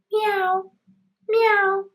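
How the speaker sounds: high little cat meows. Pretend. Boy hiding under the bed fakes a cat, high meows. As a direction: mew like a small cat. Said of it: "as if he was a cat".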